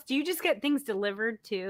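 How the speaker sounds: ordinary delivery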